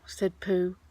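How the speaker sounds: ordinary delivery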